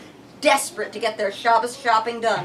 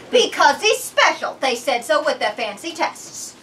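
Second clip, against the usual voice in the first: higher, insistent mother; proud mother; 4 semitones higher, 5 dB louder than usual. A narrator's reading. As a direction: defend her son firmly. Mother voiced in story.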